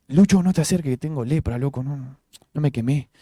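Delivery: por lo bajo, disimulado. Acted. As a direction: keep it down so nobody else finds out. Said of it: "tenía que gritar para que todo el mundo supiera". (en voz baja, con disimulo)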